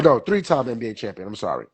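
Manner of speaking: ordinary talk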